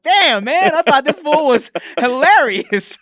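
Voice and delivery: high, loud, laughing disbelief; amazed disbelief. Retold or imitated. Friend realizing the actor just talks that way, loud and laughing. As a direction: crack up in shock at the news. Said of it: "And Eric's like".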